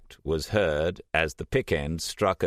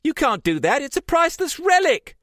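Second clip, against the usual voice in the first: high, loud furious protest; outraged shouting; 8 dB louder, faster than usual. A narrator's reading. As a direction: yell it to stop her. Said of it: "Trish screamed at her".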